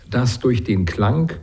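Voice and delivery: ordinary delivery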